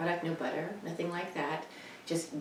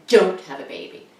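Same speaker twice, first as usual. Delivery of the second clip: a louder, blunt mock mother; mother's retort; 2 semitones higher, 11 dB louder than usual. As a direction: snap back like a bossy mother. Mother's replies retold.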